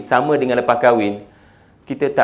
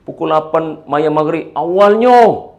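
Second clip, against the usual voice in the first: loud mock praise, pitched high; sarcastic jibe; 5 semitones higher, 6 dB louder, slower than usual. Shown as a demonstration. Parent jibing at child over early Maghrib prayer, sarcastic. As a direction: needle the child with exaggerated praise. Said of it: "Seperti ada unsur sindiran".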